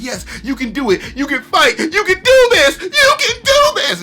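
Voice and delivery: rising to a high, loud, tearful cheer; crowd cheering. Retold or imitated. Crowd cheering on Mumen Rider, building to a high tearful yell.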